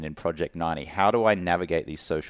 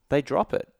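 normal talking voice

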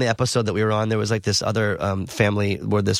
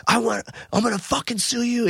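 retold losing it; 12 semitones higher than usual. Guest threatening the producer after being roughed up, mock outburst. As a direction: imitate an indignant threat, pitched way up. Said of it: "then I just kinda, like, lost it".

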